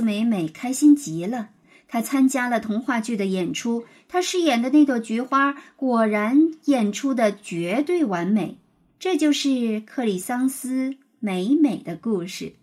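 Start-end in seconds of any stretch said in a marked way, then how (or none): none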